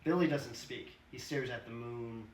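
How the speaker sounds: ordinary delivery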